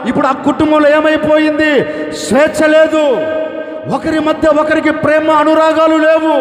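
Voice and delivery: high, loud, driving sermon cadence; preaching at full force. Acted. Pastor preaching to the congregation about their burdens, fervent. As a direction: preach it hard to the crowd.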